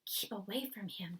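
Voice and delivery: a quiet, begging plea; soft frightened plea; pleading softly. A narrator's reading. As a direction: plead with him to keep away. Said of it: "Sophie pleaded".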